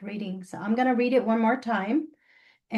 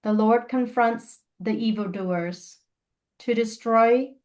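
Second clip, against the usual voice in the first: measured scripture reading, pausing between phrases; slow reading. Real, not acted. Leader rereading scripture to the group for prayer, slow reading. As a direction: read it slowly, phrase by phrase, leaving space. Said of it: "I'm gonna read it slowly".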